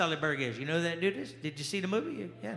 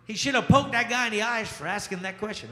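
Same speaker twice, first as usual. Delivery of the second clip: normal talking voice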